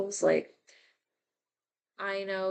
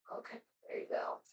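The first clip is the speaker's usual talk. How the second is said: very soft, quick murmur under the breath; quiet aside; 14 dB quieter, much faster than usual. Real, not acted. Talking to a baby spider, quiet aside. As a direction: say it low and fast, off to the side.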